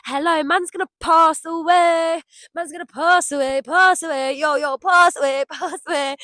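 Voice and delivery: mock hype chant; silly riff. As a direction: riff on it like a goofy rap. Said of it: "I'm just being nuts to try and entertain myself".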